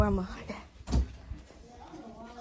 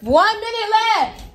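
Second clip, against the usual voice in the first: calling out; 10 semitones higher, 11 dB louder than usual. Real, not acted. Calling out time during game.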